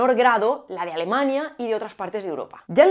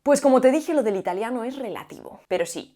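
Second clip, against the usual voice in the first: normal talking voice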